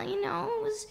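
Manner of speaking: normal talking voice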